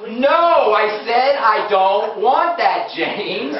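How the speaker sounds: loud, slow, emphatic telling-off; hammed-up scolding, each word leaned on; insistent scolding. Body finally yelling at James over candy, slow emphatic scolding. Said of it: "And then it's like".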